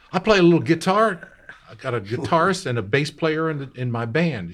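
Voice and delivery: put-on high, loud proud boy. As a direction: boast about the band. Young [name] voiced in story.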